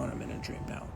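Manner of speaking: fading whisper. Shown as a demonstration. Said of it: "repeating phrase, fading to whisper".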